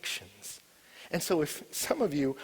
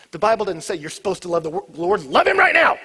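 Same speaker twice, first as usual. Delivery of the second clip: ordinary talk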